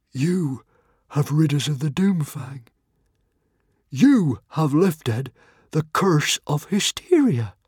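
high, slow, solemn proclamation; awed chieftain. A narrator's reading. Norbert the Nutjob declares Hiccup freed them, awed. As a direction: Norbert hails the boy, half in disbelief. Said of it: "said Norbert the Nutjob".

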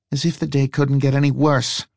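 lightly voiced muttered complaint; muttering woman. A narrator's reading. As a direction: grumble it half to yourself. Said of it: "she muttered".

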